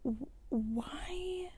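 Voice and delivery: whiny whisper. Retold or imitated. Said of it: "I was like".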